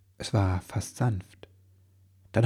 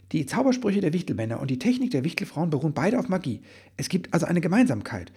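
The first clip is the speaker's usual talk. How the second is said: fast and higher, words tumbling out; bubbling out; 7 semitones higher, 3 dB louder, faster than usual. A narrator's reading. Elf man blurts out his theory, fast and bubbling. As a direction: rush the explanation breathlessly. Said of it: "sprudelte es aus Ribbelwitsch hervor".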